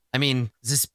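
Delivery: ordinary talk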